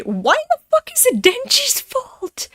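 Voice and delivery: high, loud indignant outburst; outraged parent. Acted. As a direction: explode like a furious mother defending her son. Voicing outraged parent defending son.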